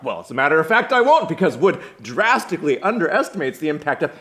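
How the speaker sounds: loud, high-pitched academic retort; Clark. Acted. Grad student answers Will with Wood's critique, loud know-it-all comeback. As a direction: push back as a show-off scholar.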